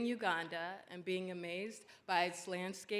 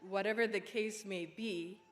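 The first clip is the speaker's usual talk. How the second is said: normal talking voice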